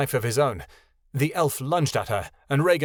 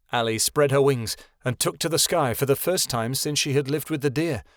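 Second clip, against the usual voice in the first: ordinary delivery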